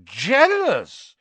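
loud, high incredulous echo; scornful disbelief. A narrator's reading. Bully answering the small boy's taunt, scornful. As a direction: throw the word back with scorn. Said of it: "who didn't need to shout any more".

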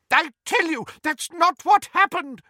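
high, loud insistence; heated protest. A narrator's reading. Old friend insisting to Muriel, high loud protest. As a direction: plead your friend's case hard.

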